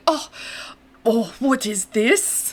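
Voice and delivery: drawn-out slow, dismayed German voice; mock German dismay at the cheese board; mother-in-law's German dismay. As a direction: imitate a German mother-in-law tasting something strange. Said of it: "I could just hear it going".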